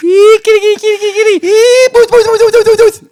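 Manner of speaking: loud falsetto kitty calls; mock cat-calling. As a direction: squeal for the cat, rapid-fire. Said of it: "And he just went".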